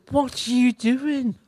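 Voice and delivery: high, drawn-out questioning mum voice; stretched, high-pitched mock maternal query; puzzled mother. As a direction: imitate a bewildered mother, pitched high and slow. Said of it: "mother said".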